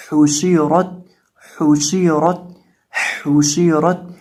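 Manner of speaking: हुशीरत with rounded lips on हा, a heavy रा and a light ता; model reading. Shown as a demonstration. Teacher to students, modelling the word aloud. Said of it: "तो इसकी आवाज़ आएगी".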